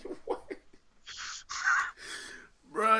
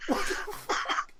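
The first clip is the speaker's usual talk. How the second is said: wheezing laugh; 12 dB quieter, slower than usual. Real, not acted.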